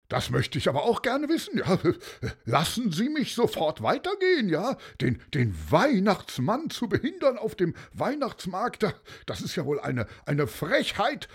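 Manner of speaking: high, fast, indignant blustering; indignant Santa. A narrator's reading. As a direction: huff and puff like an offended Santa. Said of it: "brüllte, ja, der Weihnachtsmann".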